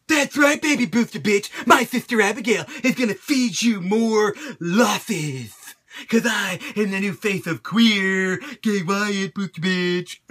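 creepy taunting character voice; mock Bray Wyatt. Bray Wyatt taunting Ryback, mocked in a creepy voice. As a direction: taunt him like a creepy cult leader.

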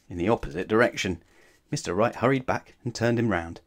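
ordinary delivery

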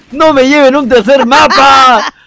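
loud, high begging cry; pleading yell to the organisers; shouted plea. Real, not acted. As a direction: beg at the top of your voice. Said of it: "Suplicas a gritos".

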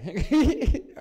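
quick high giggle; amused high little laugh. Real, not acted. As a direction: break into a short, high-pitched chuckle. Amused at his own remark.

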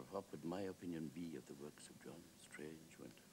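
hushed, pondering Wilde; musing echo. Acted. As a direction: hand the question back quietly, trailing away. Wilde echoes the question back.

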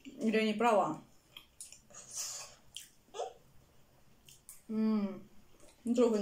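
chewing while talking; speaking between bites; mouth full. Real, not acted. Seen 0: Fork of food enters mouth at 0.1, chewing visible through 3.3.

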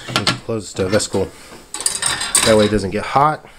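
ordinary talk

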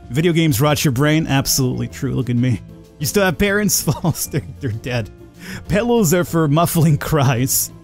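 usual speaking voice

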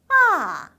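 loud 아 falling steeply from high; fourth tone. Shown as a demonstration. Teacher showing fourth tone, 아 sharply falling. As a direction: start 아 high and let it slide right down. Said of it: "사성은 떨어지는 음이에요".

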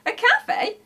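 very high, loud astonished question; gasping surprise. A narrator's reading. Shifty reacts to the cafe idea, gasping. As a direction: gasp the question in amazement. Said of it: "gasped Shifty".

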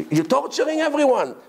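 high-pitched acted protest; exasperated complaint. Congregant scolding the cantor for long prayers, exasperated.

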